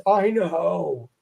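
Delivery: drawn-out "I know", both words stretched; knowing acknowledgment. Acted. Teacher voicing what the meteg mark says, drawn-out knowing tone. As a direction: voice the scribe's long-suffering admission. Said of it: "It's supposed to reduce, but it didn't".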